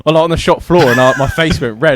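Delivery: usual speaking voice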